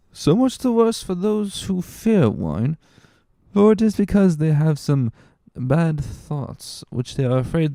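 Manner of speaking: put-on high, laughing drunken bluster; tipsy Caderousse. A narrator's reading.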